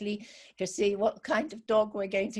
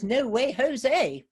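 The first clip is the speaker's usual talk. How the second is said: louder, cheeky singsong no way Jose; cheeky refusal; 6 semitones higher, 6 dB louder, much slower than usual. Acted. Field mouse turning down little rabbit, louder and cheeky. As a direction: tease him with a flat refusal.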